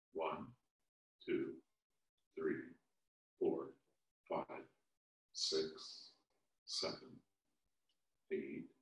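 soft, low, half-whispered counting; barely voiced murmured count; counting under breath. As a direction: count quietly to yourself. Molly counting spots under breath.

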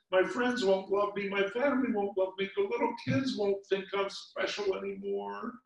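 high anxious rush of worry; high, panicky distress; panicked. Acted. Lindy panicking over lost spot.

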